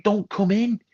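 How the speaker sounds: very high-pitched exasperated rant; indignant telling-off. Retold or imitated. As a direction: re-enact a heated cinema telling-off. Acted telling-off of phone user.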